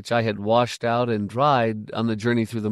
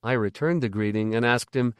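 ordinary delivery, nothing marked

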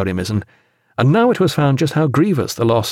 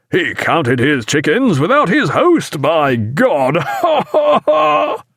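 slow, delighted crow rising into laughter; gleeful cry; 6 semitones higher, slower than usual. A narrator's reading. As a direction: crow in triumph, then laugh high. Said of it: "stamped upon the frozen deck and cried".

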